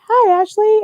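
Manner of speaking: high, bright mock greeting; high-voiced retelling of a guy's hello; imitating a friendly guy. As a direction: imitate him calling her name cheerfully. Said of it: "and he's like".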